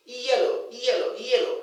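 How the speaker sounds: ee-yellow, said emphatically; glide pronunciation. Shown as a demonstration. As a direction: lean into the ee glide starting yellow. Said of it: "There's a little e sound there".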